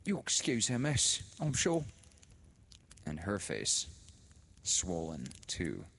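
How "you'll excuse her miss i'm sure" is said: high, louder, oily apology; fawning sister. A narrator's reading. The sister apologising to Laura for the widow, oily. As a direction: apologise with a greasy smile. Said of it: "again she began".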